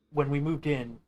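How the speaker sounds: ordinary delivery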